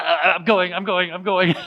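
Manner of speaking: high, laughing, flustered reply. Retold or imitated. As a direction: answer them while laughing it off.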